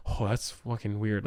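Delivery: low, slow, softened reaction; muted unease. Retold or imitated. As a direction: say it under your breath, slowly. Viewer's reaction being voiced.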